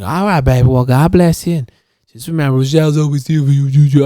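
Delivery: quick, put-on neighbor woman's voice; Rochelle. Neighbor sending him off with a blessing, quick impression. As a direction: mimic the neighbor's blessing in a rush. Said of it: "Rochelle talks like that".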